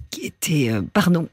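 choking mid-sentence. Real, not acted. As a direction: voice catching as she starts choking. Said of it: "je m'étouffe".

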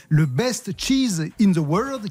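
put-on slow English with a thick French accent. Reading a cheese ranking title to listeners, in heavy French-accented English. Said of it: "toujours avec mon accent légendaire".

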